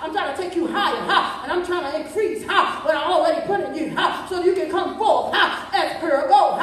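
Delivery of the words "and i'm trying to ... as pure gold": normal talking voice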